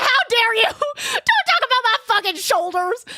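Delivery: loud, high, laughing outrage; outraged yell. Retold or imitated. Snapping back at the godmother about her shoulders, loud outraged yell. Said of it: "I was like".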